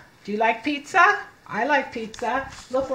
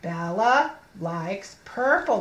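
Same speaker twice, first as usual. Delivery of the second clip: slow reading; 3 semitones lower, slower than usual. Shown as a demonstration. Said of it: "I put my finger under the first letter".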